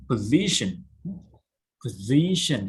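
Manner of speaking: position with the S said as Z. Shown as a demonstration. Teacher explaining to students, S said as Z. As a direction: say position with a z sound, stress on zi. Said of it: "S I here pronounce Z".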